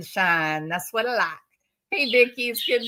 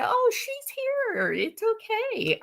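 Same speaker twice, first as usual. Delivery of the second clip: very high, cooing comfort; acted reassurance; 12 semitones higher, 4 dB quieter than usual. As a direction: soothe a lost child in a high voice. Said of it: "get 'em grounded and say".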